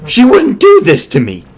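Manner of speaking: high desperate yell, slowing at the end. A narrator's reading. Doctor yelling desperately at himself, high and loud. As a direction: yell at yourself in denial. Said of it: "He sounded desperate".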